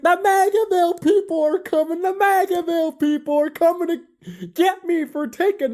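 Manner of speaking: high, panicky mock fright; scared. A narrator's reading. Harry frightened the villagers are coming for him, high panic. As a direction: squeak it out as if they're coming for you. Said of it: "Harry was scared".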